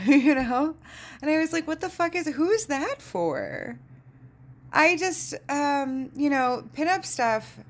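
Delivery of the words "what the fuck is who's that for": high-pitched, baffled disbelief; acted-out bafflement, stretching the final question; incredulous. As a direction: retell your bewildered question, pitched way up. Retells own baffled reaction to waiter.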